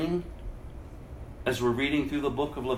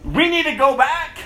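higher, louder urgent insistence; urgent plea; 12 semitones higher, 8 dB louder than usual. Retold or imitated. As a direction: plead to go home. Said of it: "she was like".

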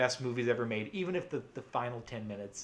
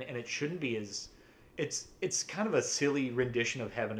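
usual speaking voice

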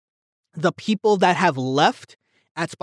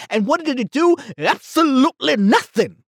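heated rant; 7 semitones higher than usual. Real, not acted. Vlogger venting about the pointless scene, heated and high. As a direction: vent real frustration at the scene. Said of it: "I apologize for getting heated".

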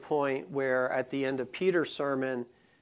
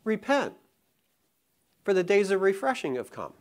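Peter, lightly voiced; 6 semitones higher than usual. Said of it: "and Peter says".

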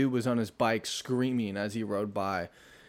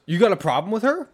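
imitating aggressive man; 8 semitones higher, 6 dB louder than usual. Aggressive stranger quoted.

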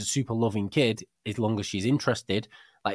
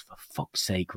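muttered curse; 3 semitones lower, 7 dB quieter, much slower than usual. Retold or imitated. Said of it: "and I go".

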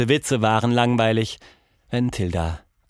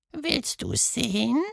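very high, soft, slow mysterious question; hushed high teasing creature; eerie creature; 14 semitones higher, 3 dB quieter, slower than usual. Acted. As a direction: lure her in softly. Caterpillar rears up.

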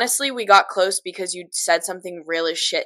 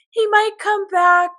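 higher, sing-song mock hope; mocking fake hope; 12 semitones higher, 7 dB louder than usual. Mocking friends who said he'd return, high sing-song. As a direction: parody the naive friends, high.

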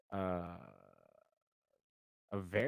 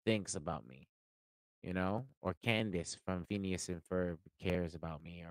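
normal talking voice

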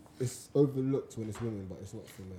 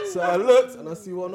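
laughing; 5 semitones higher, 11 dB louder than usual. Real, not acted. Story punchline, laughing.

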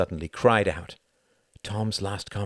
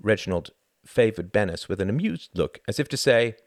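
plain reading voice

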